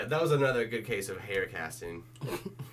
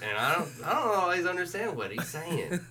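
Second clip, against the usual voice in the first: higher, laughing put-on voice; mock own inner voice, lightly pitched up; inner thinking voice; 7 semitones higher than usual. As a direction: play your baffled thoughts through a laugh. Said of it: "That's how I think on the inside".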